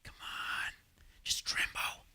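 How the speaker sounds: hushed whisper to himself; under-the-breath coaxing. Retold or imitated. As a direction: breathe it like a secret urge. Soldier urging himself to tremble.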